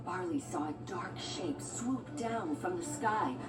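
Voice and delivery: ordinary delivery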